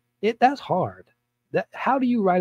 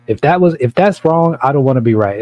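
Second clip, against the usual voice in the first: loud defiance; 2 semitones higher, 8 dB louder than usual. Real, not acted. Rant peaks, high and loud.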